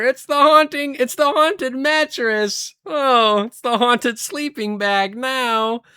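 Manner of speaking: high, drawn-out cartoon fright; high-pitched mock-scared wail; SpongeBob. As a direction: imitate a panicked cartoon character, high and stretched. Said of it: "where SpongeBob's like".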